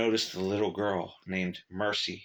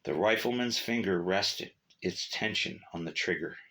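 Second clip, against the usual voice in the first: usual speaking voice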